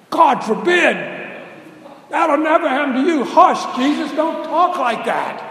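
high, loud, alarmed protest; Peter's protest. Acted. Peter protesting loudly to Jesus, high and alarmed. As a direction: cry out in horror to stop him.

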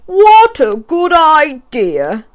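drawn-out delighted agreement; Zog's eager voice. A narrator's reading. Zog answering the girl, slow and pleased. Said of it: "said Zog".

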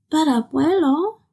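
put-on childish whining pout. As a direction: plead like a sulky child. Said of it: "Clara pouted".

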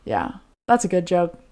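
ordinary talk, nothing marked

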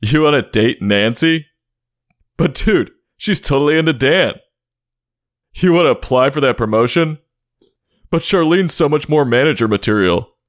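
high, dismissive know-it-all friend; naysaying buddy voice. Pretend. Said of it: "that one person who shits on your ideas".

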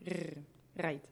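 "Rrr, right" growled in the back of the throat; throaty French R on "Rrr, right". Shown as a demonstration. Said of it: "they put the R in the back".

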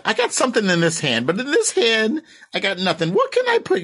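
high, squawky Gilbert Gottfried imitation; quoting Gilbert Gottfried. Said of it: "Remember the scene".

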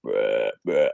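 low grunting buck call; deer grunt. Retold or imitated. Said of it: "tending grunt right on her tail".

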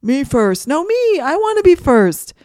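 loud, high pushy shouts; shouting kids. A narrator's reading. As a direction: yell it like squabbling kids shoving to the front.

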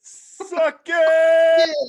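high yelled crowd chant; shouted catchphrase. Pretend. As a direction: belt it out like the arena crowd. Crowd catchphrase after DX intro.

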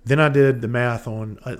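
ordinary talk, nothing marked

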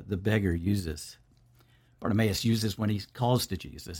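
normal talking voice

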